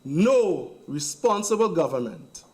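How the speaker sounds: measured, each word given room; slow and deliberate. Real, not acted. MP hammering a point to parliament, slow and deliberate. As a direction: spell it out slowly for emphasis. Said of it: "I'll say it slowly".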